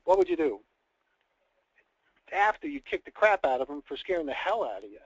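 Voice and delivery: usual speaking voice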